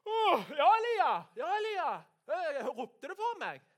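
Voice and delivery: high, loud startled shout; startled calling back. Acted. Woken Samuel shouts to Elia, startled high yell. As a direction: jolt awake and holler, high-pitched.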